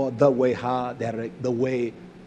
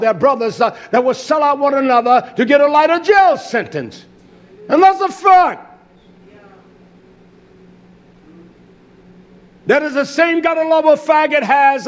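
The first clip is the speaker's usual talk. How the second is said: raised, driving sermon delivery; forceful preaching; 9 semitones higher, 7 dB louder than usual. Real, not acted. Preacher condemning false brotherly love, forceful preaching. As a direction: preach it hard, punching key words.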